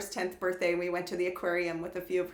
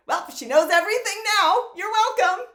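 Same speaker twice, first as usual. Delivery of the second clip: high, loud mock-cheerful news breaking; hammed-up, pitched-up punchline on the phone; brazen announcement; 11 semitones higher, 7 dB louder than usual. Mother to innocent girl's mom, brazen mock announcement. Acting awkward call to other mom.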